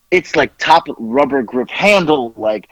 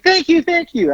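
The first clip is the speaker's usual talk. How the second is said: mock excited thanks. Amazon agent thanking excitedly.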